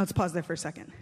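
normal talking voice

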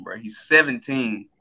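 usual speaking voice

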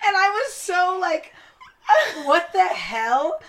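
high, loud, laughing and crying in disbelief; laugh-shout it through tears of disbelief; shocked laugh-cry. Real, not acted. Shocked recounting racist remark.

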